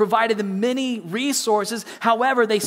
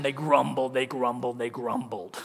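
sinking lower and quieter each time, grumbling it out; low grumbling growl; 7 semitones lower, 4 dB quieter than usual. Shown as a demonstration. Preacher showing his congregation the sound of grumbling, low and growly. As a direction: growl the word like a complaint under the breath. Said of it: "a word that sounds just like the action".

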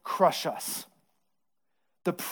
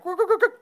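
high-pitched mock record scratch; record scratch noise; 13 semitones higher, faster than usual. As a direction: imitate a DJ scratching the record, much higher.

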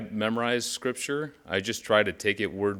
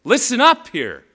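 much higher, loud wake-up call; urgent call; 13 semitones higher, 11 dB louder than usual. Acted. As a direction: snap them awake. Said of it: "This is Peter throwing water on your face".

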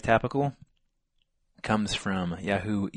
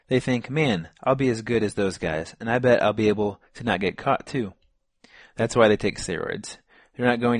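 reading aloud; 2 semitones higher, 4 dB louder than usual. Acted. Reading a user's answer aloud.

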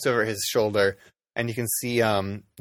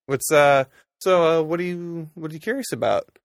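hesitant, higher-pitched questioning; lightly voiced dragonborn; 6 semitones higher, 4 dB louder than usual. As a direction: feel your way into a puzzled question. Dragonborn questions the odd interviewer.

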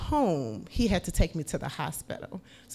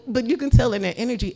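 usual speaking voice

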